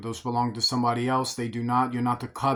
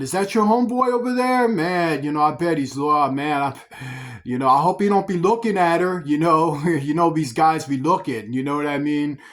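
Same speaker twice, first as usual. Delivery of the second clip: coveting smooth-talker; 3 dB louder than usual. Retold or imitated. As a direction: fawn over the homeboy, fishing for the wife. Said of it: "that guy talking for whom I'm emulating".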